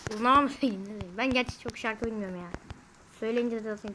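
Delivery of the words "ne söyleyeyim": much softer falling mumble; muttered to himself. Real, not acted. Vlogger wondering aloud which song to sing, softly muttered. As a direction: drop it under your breath. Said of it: "ben size bir şarkı söyleyeyim".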